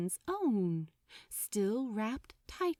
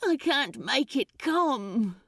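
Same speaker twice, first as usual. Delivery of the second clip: desperate distress; 4 semitones higher, 4 dB louder than usual. Acted. Demon desperate, can't summon iceberg.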